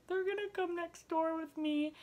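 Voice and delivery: high-pitched mock crying voice; hammed-up distressed whine; imitated tearful panic. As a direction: squeak it out as if in tears. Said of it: "why are you crying".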